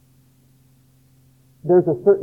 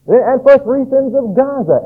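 prophet proclaiming; 8 semitones higher, 4 dB louder than usual. Retold or imitated. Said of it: "And he begins to name".

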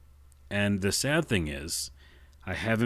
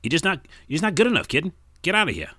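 put-on high, loud fast-talking old-time tough guy; 1920s wiseguy character; 10 semitones higher, 6 dB louder, faster than usual. Tough guy telling the kid to scram, loud wiseguy. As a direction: shoo the kid off like a gruff 1940s mobster. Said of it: "my nineteen twenties or forties characters".

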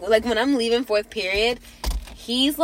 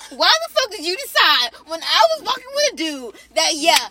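loud, high heated rant; 7 semitones higher, 6 dB louder than usual. Retold or imitated. Ranting at the girl who tagged along, loud and high. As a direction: vent the anger full force.

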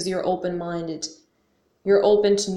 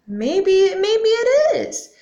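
much higher, slow, drawn-out wondering maybe; open-minded shrug; 12 semitones higher, 7 dB louder, much slower than usual. Retold or imitated. Mocking her new self to the viewers, high slow musing. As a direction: float it up high and slow, half teasing. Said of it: "Now I'm like".

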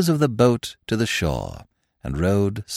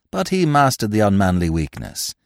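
usual speaking voice

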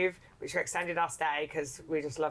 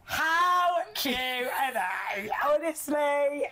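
high, drawn-out gushing coo; slow, high-pitched adoring squeal; 8 semitones higher, much slower than usual. Real, not acted. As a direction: stretch it out slowly, adoring and high. Gushing over pictures of the couple.

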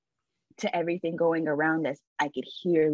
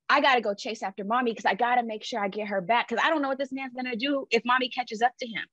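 urgent; 6 semitones higher than usual. Retold or imitated. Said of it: "I'm like".

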